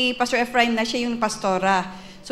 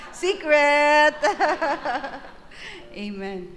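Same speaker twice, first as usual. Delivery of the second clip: laughing; 3 semitones higher, slower than usual. Real, not acted. Joking about pastor's age.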